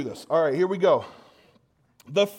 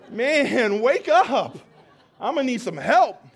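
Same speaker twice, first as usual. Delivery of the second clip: loud, high, laughing call to the crowd; laughing wake-up call; 5 semitones higher, 6 dB louder than usual. Real, not acted. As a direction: jolt the room awake with a laughing shout. Pastor rousing a sleepy congregation.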